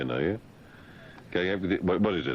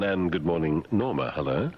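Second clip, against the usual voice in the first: ordinary talk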